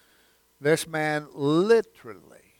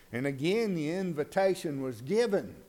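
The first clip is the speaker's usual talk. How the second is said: usual speaking voice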